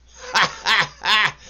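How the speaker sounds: high, loud mocking cackle; cackling laugh. A narrator's reading. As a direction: laugh at him outright. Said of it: "What are you cackling about".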